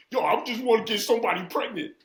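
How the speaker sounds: high, loud, rushed comic impression; Tracy Morgan. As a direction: blurt it out loud in an exaggerated comedian's voice. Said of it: "like Tracy Morgan being like".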